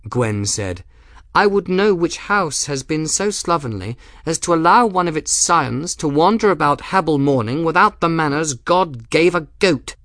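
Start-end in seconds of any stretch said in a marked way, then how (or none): 1.3-10.0 high, haughty scolding, steadily sustained; imperious, raised-pitch tirade; indignant noblewoman. A narrator's reading. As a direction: berate him like an affronted lady. Voices Gwen's indignant tirade.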